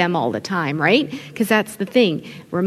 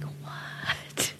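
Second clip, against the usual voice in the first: mock-shocked, hushed "what"; stunned whisper; 14 dB quieter than usual. Husband reacting to his new Italian in-laws, stunned whisper. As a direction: whisper it in playful disbelief.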